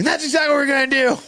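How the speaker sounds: cop reply. Retold or imitated. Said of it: "he's like".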